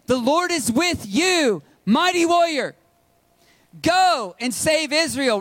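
high, slow, ringing proclamation of God's call; booming preacher voicing the angel; rousing call. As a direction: call him out like a herald, building.

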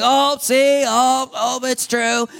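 high, loud lament of someone triggered; mock despair. A wounded person believing the lies come back, in mock despair. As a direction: wail it in mock dismay, pitched well up.

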